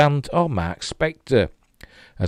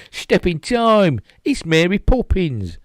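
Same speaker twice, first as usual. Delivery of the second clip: high, slow hammed-up mock Cockney; sing-song Mary Poppins chimney-sweep voice; 10 semitones higher, slower than usual.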